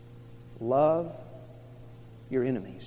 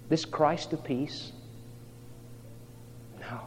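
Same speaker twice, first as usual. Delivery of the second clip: ordinary delivery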